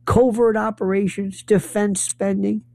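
normal talking voice

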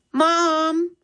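put-on boy calling his mother; calling Mom. A narrator's reading. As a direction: call out to Mom. Max calls to the living room.

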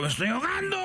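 high-pitched mock cry for help; panicked donkey. Donkey drowning in the swamp, mock panicked cry. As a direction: squeal it like a cartoon drowning donkey.

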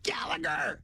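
a strict coach barking from forty yards; mock coach bark. Coach blows whistle, barks order.

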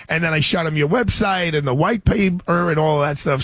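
ordinary talk, nothing marked

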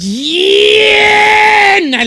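laughing mock shout, slow and drawn out; hammed-up laughing jeer. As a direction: cackle through the words as if mocking proud parents. Mocking bragging parents.

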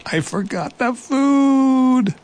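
very high, slow squeaky wail; dismayed little mouse. Acted. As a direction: stretch it out high and forlorn. Mouse's sad confession.

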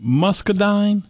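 muscadine asked with a rising, questioning lilt; checking how to say muscadine, rising at the end; unsure pronunciation. Real, not acted. Asking the Southern friend how to say muscadine, tentative rise. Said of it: "Is that how you pronounce it?".

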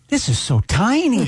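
TV show guest. Retold or imitated. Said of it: "every episode starts with".

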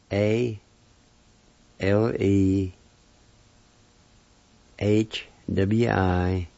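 letters given one by one, long gaps; spelled out phonetically. Shown as a demonstration. Teacher spelling the word for period, spelled out. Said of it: "It's spelled this".

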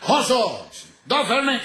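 high, slurred barroom shout; acted drunk summoning the waiter; tipsy call for waiter. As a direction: holler the order up high, drunkenly. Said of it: "ya se le calentó el pico y se desbarrancaron".

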